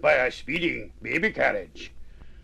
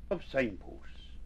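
ordinary delivery

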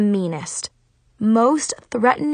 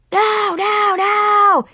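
furious high scream; 12 semitones higher, 6 dB louder, much slower than usual. Acted. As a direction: scream it in a rage. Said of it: "he screamed and stomped".

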